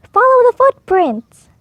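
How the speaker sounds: high, loud call to the horse; eager command. A narrator's reading. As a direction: urge the horse on with gusto. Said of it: "Sir Pete says to his horse".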